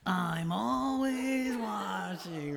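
slow, lightly voiced crotchety boss; a mild drawn-out mock warning; hint of Roz. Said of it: "She'll be like".

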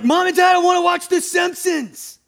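put-on high, loud whining child voice; whiny kid. Child whining to parents about The Simpsons, high and loud. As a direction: whine like a six-year-old begging. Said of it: "I'm like".

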